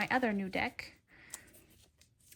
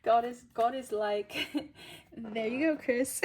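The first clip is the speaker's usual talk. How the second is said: louder, amused, laughs between phrases; laughing; 2 semitones higher, 5 dB louder than usual. Real, not acted. Reader joking about God's answer, laughing. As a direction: keep chuckling as you talk.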